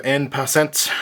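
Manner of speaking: "En Passant" in a light mock French accent, nasal "En"; French accent attempt. Shown as a demonstration. Card vlogger naming the card in a light mock French accent. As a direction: say "En Passant" the French way, only half committed. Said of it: "tried my best French accent there".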